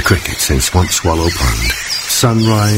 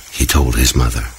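plain reading voice

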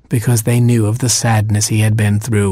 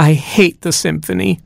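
weeping; 6 semitones higher than usual. A narrator's reading. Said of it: "said the queen and started to weep".

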